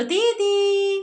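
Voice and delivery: high, sustained model word; coaxing a parrot. Shown as a demonstration. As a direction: hold the word high for a bird to copy. (तोते को सिखाती ऊँची, खिंची आवाज़)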